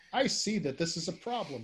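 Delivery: mock tough-guy present tense; Runyon present tense. Shown as a demonstration. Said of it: "they'll just say".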